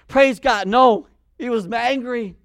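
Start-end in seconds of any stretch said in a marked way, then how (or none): 0.1-0.7 mock praise. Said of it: "He was like".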